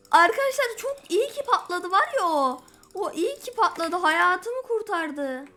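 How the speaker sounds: loud, high, breathless relief; excited relief. Real, not acted. Gamer tells viewers the blast saved him, excited and loud. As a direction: gush to the viewers that it saved you.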